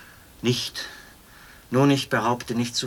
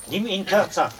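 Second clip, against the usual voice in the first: barked order; 8 semitones higher than usual. Acted. Officer barks order at soldier.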